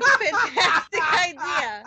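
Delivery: loud, high, through laughter; mock enthusiastic. Woman retelling her reply to a bracelet idea, gleeful. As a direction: shout it with over-the-top delight, cracking up. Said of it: "I was like".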